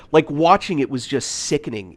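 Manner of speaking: usual speaking voice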